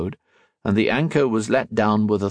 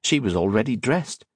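ordinary delivery

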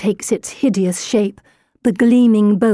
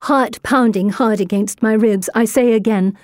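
plain reading voice

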